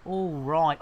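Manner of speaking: normal talking voice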